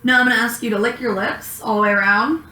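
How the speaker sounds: ordinary delivery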